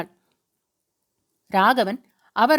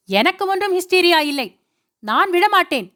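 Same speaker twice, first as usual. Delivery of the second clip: defiant outburst; 5 semitones higher than usual. A narrator's reading. Hysterical wife defies them.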